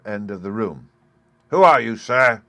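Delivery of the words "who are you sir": loud, high demand; demanding who the intruder is. A narrator's reading. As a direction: bark the question at the stranger. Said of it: "demanded Schwartz".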